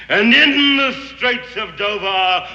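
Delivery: normal talking voice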